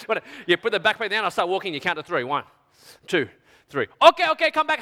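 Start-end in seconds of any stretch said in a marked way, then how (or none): 4.0-4.8 high, loud, urgent call; calling back. Acted. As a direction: call the customer back in a hurry. Seller calls departing buyer back.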